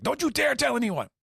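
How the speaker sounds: embarrassed warning. Retold or imitated. Loki embarrassed, warning Gerd.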